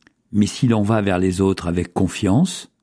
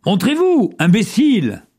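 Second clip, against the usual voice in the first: high-pitched angry call; cri de colère; 12 semitones higher than usual. A narrator's reading. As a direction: cry out angrily to someone hiding. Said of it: "Cela le mit en colère". (crier en colère, très aigu)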